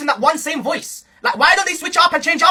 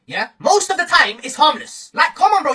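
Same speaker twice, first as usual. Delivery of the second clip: ordinary talk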